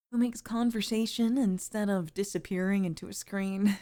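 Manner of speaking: ordinary delivery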